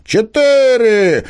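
high, loud chorus answer; shouted in chorus. A narrator's reading. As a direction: shout it out like a class. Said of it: "хором отвечали ребята".

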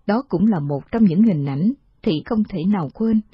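ordinary delivery, nothing marked

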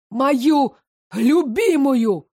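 slow, high, loud drugged wail; raving. Acted. Drugged adjutant defending his beloved, slow high wail. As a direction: stretch the words out like a madman. Drugged boy raves about love.

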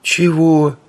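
high, puzzled question; startled. A narrator's reading. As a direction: caught off guard, asking back. Startled Oleg asks.

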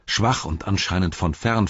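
plain reading voice

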